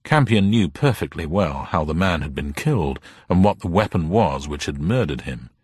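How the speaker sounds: ordinary delivery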